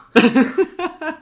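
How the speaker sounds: loud cackling laugh; loud high laugh. Real, not acted. Laughing at own story.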